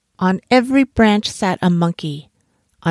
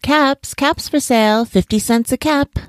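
high, sing-out hawker's call; peddler's street cry; 7 semitones higher than usual. A narrator's reading. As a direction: call out your wares to the street. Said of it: "he called".

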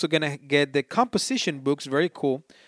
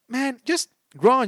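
exasperated mock plea; 10 semitones higher, 3 dB louder, slower than usual. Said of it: "I'm like".

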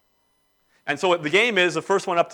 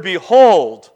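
"behold" much louder, falling at the end; emphatic behold; 9 dB louder than usual. Shown as a demonstration. Preacher quoting the word Sibbs studied, emphatic "behold". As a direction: proclaim "behold" with weight on the second syllable. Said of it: "Sibbs took the word".

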